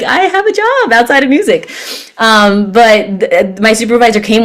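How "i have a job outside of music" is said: playful retort. Retold or imitated. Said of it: "And I'm like".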